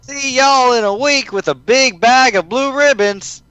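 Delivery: cheerful Southern cowgirl; Southern accent. Acted.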